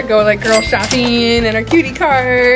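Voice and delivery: ordinary talk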